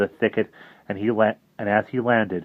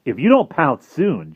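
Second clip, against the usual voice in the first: hissing urge; 6 semitones higher, 6 dB louder than usual. Acted. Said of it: "Jive hissed".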